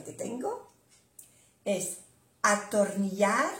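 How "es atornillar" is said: ordinary delivery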